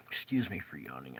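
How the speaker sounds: soft unvoiced whisper of "excuse me for yawning"; the same apology repeated as a whisper. Real, not acted. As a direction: breathe the apology out almost silently.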